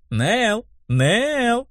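high, stretched name calls; crying out. A narrator's reading. Brunette teasing her friend, high mock cries. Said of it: "опять закричала брюнетка".

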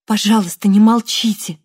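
loud, urgent plea; pleading. Acted. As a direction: beg him not to fall silent. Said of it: "попросила Вероника". (умоляюще, громко)

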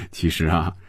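light laugh leading into speech; chuckle, then begin explaining; amused chuckle. A narrator's reading. Man answering her puzzled question, amused chuckle. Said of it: "红军笑着说".

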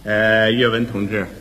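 teacher neighbour. Acted. Teacher offering chicken to a stricken neighbour, low and solemn. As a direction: speak deep and deliberately, like a solemn schoolteacher. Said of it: "我们东屋里边薛老师".

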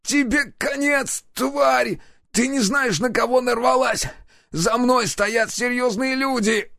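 high, strained voice of a wounded thug; wounded captive threatening his captors. Acted. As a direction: spit threats through the pain.

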